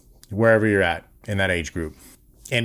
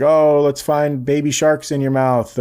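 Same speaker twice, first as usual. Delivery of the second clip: lightly hammed-up game voice, higher and drawn out; slow, raised-pitch make-believe with a child; playful parent; 5 semitones higher, 4 dB louder than usual. Dad turns toothbrushing into a game for his kid, playful.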